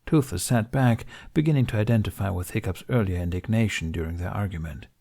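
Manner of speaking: ordinary delivery, nothing marked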